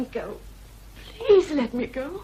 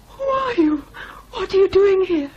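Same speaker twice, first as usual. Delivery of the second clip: higher, louder, panicked; frightened cry; 4 semitones higher, 6 dB louder than usual. Acted. Lockwood confronting the ghost at the window, panicked cry. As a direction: shout it out in terror.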